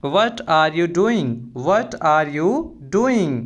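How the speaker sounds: slow, carefully spelled-out English phrase; slow and clear classroom model phrase; slow teaching demo. Shown as a demonstration. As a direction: say each word slowly for the learner. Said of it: "तो आपको बोलना है".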